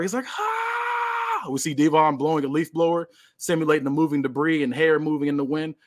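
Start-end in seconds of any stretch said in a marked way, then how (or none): 0.4-1.5 high anime power-up shriek; power-up scream. Acted. Wrestler going Super Saiyan, high power-up scream. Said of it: "he's screaming louder".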